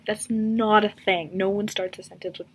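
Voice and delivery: ordinary talk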